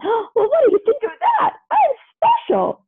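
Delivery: high, delighted exclaiming; overjoyed. Acted. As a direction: burst with pride. Pluto overjoyed.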